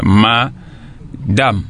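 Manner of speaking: madame broken into MA and DAM; two-part split. Shown as a demonstration. Teacher quoting the child's split of the word, badly separated. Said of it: "vous n'avez pas bien séparé".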